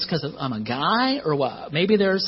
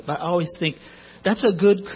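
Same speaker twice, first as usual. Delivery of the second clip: ordinary talk, nothing marked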